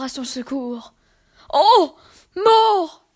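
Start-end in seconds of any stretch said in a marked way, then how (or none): 1.5-3.0 high, loud cry to death; anguished cry. Acted. Poor man crying out to death, loud and high. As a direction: call out to death in despair. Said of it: "lui disait-il".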